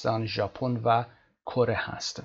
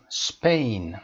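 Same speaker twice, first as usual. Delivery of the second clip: اسپین said as one strong loud syllable; single stressed syllable; 5 dB louder, slower than usual. Shown as a demonstration. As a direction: say اسپین as one punched beat. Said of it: "استرسش روی خودشه". (اسپین با تأکید کامل)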